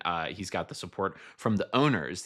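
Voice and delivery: ordinary talk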